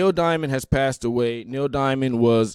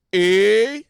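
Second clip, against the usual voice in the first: loud high wise guy hey; mock Jersey tough guy greeting; Paulie D; 8 semitones higher, 7 dB louder than usual. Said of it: "Here's Paulie".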